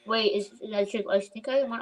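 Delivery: ordinary delivery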